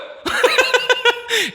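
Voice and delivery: high-pitched peal of laughter; laughing. Real, not acted. Preacher cracks up mid-sermon, high real laugh.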